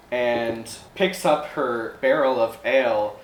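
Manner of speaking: ordinary talk, nothing marked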